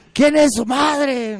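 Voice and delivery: loud, high question, very drawn out; arenga a la multitud. Acted. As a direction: bellow it slowly to a whole plaza. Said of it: "Le dice". (pregunta gritada a la multitud, lenta y aguda)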